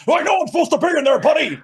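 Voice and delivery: high, loud, fast mock of Max. Friend mocking Max's snappy reply, high and fast. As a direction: squeak out Max's grumpy excuse.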